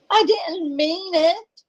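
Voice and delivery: high, slow, trembling-edged plea; frightened plea. Acted. Maggie begging Vic after he orders her out, high and slow. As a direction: stretch the words out in fear.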